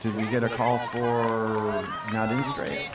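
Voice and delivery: normal talking voice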